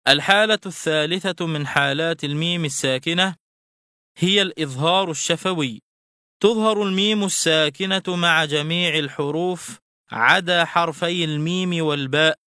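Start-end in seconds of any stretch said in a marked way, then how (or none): none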